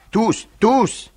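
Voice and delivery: a very high, loud shout; crowd outcry. Acted. The Jacobins shouting their loyalty to Robespierre, high loud outcry. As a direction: cry it out like a whole hall shouting. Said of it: "s'écrient les Jacobins".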